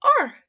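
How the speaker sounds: high, loud startled shriek; scream. A narrator's reading. Bear screams back at the owl, loud shriek. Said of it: "screamed Albert".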